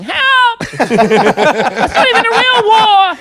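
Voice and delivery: high, slow, loud tearful wail; mock crying wail. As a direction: wail it out through fake sobs.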